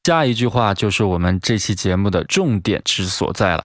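ordinary talk, nothing marked

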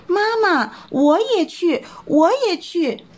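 high little bunny pleading with mother; little rabbit begging. Acted. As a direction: tug at mum and beg to come along. Little rabbit begs to come.

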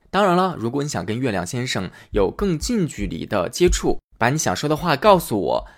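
plain reading voice

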